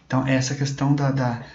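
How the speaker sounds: ordinary talk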